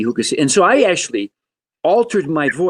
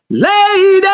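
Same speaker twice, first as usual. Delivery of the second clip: much higher, louder squeaky Jerry Lewis "Lady"; 7 dB louder than usual. Retold or imitated. Guest showing the host his childhood Jerry Lewis, high and loud. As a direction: yelp it like a goofy Jerry Lewis. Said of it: "I wanted to be Jerry Lewis".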